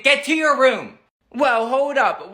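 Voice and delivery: ordinary talk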